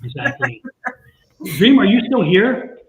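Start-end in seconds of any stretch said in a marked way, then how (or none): 1.6-2.8 called out louder, slow and drawn; slow, louder call into the room. Real, not acted. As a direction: call out to someone who may have left. Calling to an absent viewer.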